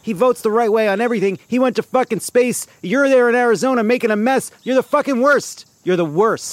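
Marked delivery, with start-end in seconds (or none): 0.0-4.5 rising, louder exasperated rant; angry rant. Real, not acted. [name] ranting at the senator, exasperated. 4.6-5.7 loud, high yell on fucking worst; furious yell. Real, not acted. [name] yelling at the senator, furious. Peak of [name]'s rant.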